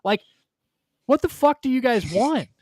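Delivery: exasperated yell at whining Browns fans; retold rant. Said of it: "I'm like".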